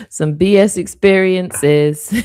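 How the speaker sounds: laughing through B S experiences. Real, not acted. Psychic jokes to viewers about bad choices, laughing. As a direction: giggle your way through it.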